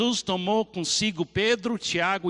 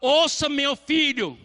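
slow, loud, higher shout; God shouting; 7 semitones higher, 6 dB louder than usual. Acted. God shouting from heaven at the busy doer. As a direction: shout it down from the sky. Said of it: "ele tem que gritar em voz alta".

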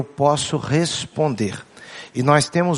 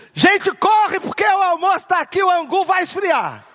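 shouting mother; 14 semitones higher than usual. Retold or imitated. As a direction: yell across the house that lunch is getting cold. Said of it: "Já começa gritando".

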